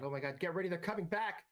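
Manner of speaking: mild yell, pitch climbing toward the end; yelled warning. Acted. Game master voices woman near truck warning the party, half-yelled. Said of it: "as she yells out".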